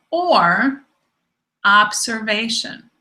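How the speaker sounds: "or" stretched and swooping, then a pause before "observation"; stress on OR. Shown as a demonstration. Teacher giving the key word of a definition, "or" long and emphatic. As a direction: hang on "or", then set "observation" down deliberately. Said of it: "that's the key word".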